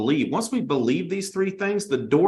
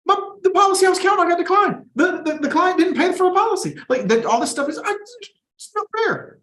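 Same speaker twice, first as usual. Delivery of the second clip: much higher and louder, wailing mock complaint; whiny agent; 14 semitones higher, 4 dB louder than usual. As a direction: whine like a sore agent crying unfair. Mocking an agent whining about declines.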